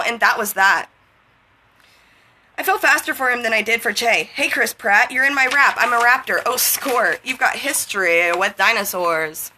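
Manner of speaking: rhythmic slam-poetry recital; lilting, driving spoken-word flow. Acted. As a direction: perform it as spoken word, riding the rhymes. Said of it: "reading it sort of as a spoken word".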